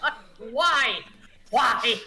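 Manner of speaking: muffled frustrated yell from inside. Acted. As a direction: shout it as if walled in. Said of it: "you just hear her muffled voice".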